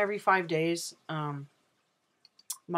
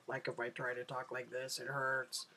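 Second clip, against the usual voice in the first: strained low talk, lower and much softer; forced low voice; 4 semitones lower, 10 dB quieter than usual. Shown as a demonstration. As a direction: push your voice down uncomfortably low and quiet. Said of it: "if I tried to talk low".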